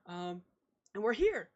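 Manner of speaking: put-on high, sweet gushing girl voice; chirpy young woman, all smiles; bubbly sister. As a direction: gush over them like an eager little sister.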